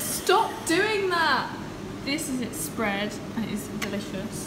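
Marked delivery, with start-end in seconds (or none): 0.0-1.5 high, loud, slow mock yell; hammed-up scolding cry; mock shout.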